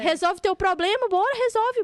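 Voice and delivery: loud, high-pitched pushy order; mock parent pressuring a child; cobrança impaciente. (cobrando alto, com impaciência)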